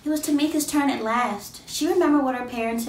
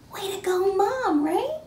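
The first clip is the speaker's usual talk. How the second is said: playful excited; 9 semitones higher than usual. A narrator's reading. Asking listeners, playful.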